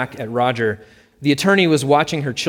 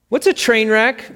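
put-on higher, louder childish question; curious little girl; 11 semitones higher, 5 dB louder than usual. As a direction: ask it like a giggly eight-year-old. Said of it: "Maisie asked".

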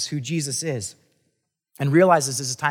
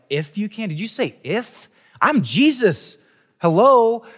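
mock sarcastic Jesus; 7 semitones higher, 7 dB louder than usual. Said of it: "maybe someone might read it as".

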